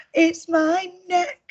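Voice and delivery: high, slow giraffe voice; storybook giraffe complaining. A narrator's reading. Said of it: "said the giraffe".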